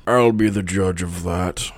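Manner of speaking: slow, deliberate authority figure; a hint of an old priest, words drawn out. Game master voices high priest.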